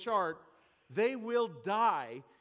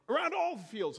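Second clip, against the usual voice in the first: mock outraged protest; 6 semitones higher, 5 dB louder, faster than usual. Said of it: "And I'm like".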